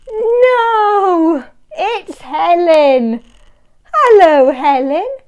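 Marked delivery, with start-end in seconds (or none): none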